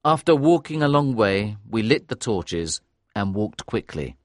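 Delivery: usual speaking voice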